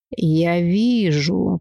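very slow, stretched words; drawn out. Acted. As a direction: drag it out like a seer. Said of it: "протянула девица".

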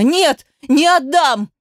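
high, drawn-out desperate refusal; frantic cry. A narrator's reading. Mother refusing to give up her child, frantic. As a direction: cry out in protest, high and slow. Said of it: "закричала истошно".

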